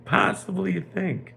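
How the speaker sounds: high, swooping, incredulous mockery; scoffing king. King mocks the idea Reuven would return, scoffing. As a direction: scoff in stretched, leaping pitch. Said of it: "the king is laughing to himself".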